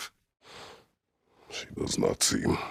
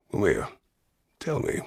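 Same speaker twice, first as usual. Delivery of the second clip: ordinary delivery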